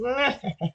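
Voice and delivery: high villain cackle; mustache twirling villain. Pretend. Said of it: "Still working on my villain laugh".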